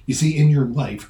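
ordinary talk

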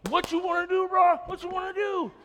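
high-pitched, pumped-up taunt; hyped-up challenge. Retold or imitated. Hyped up facing three or four guys, high pumped-up challenge. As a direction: get really into it and dare them. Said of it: "I'm getting hyped".

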